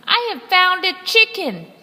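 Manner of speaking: high, loud triumphant pirate; gleeful pirate shouting. Acted. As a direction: crow it out to the crew. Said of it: "shouted a one-armed pirate".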